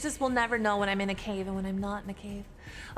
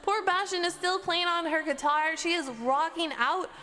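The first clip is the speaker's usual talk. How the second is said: arena announcer; 7 semitones higher than usual. Acted.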